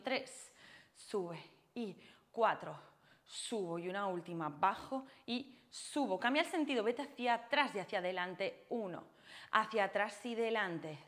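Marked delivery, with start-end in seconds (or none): none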